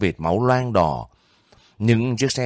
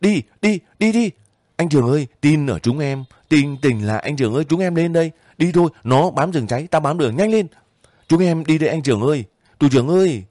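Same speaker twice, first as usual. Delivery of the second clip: very high, loud urgent yelling; urgent shouts; 7 semitones higher, 4 dB louder, faster than usual. A narrator's reading. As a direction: cry out to the wounded commander as they run. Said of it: "Những tiếng quát lại đua nhau bật lên".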